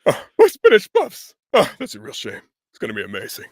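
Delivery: big lug impression, dismay then deadpan; Kronk. Voice actor as cartoon henchman fretting over spinach puffs, impression. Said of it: "Or if it's Kronk from Emperor's New Groove".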